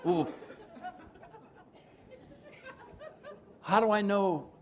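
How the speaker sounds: shocked newcomer questioning his sponsor; incredulous what. Retold or imitated. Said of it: "I said".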